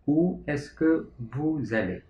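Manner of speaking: "Où est-ce que vous allez?" said slowly, each word apart, falling at the end; slow repeat. Shown as a demonstration. Teacher repeating the French question slowly for students. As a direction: say it again slowly so students catch every word. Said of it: "menggunakan kata bantu tanya est-ce que menjadi".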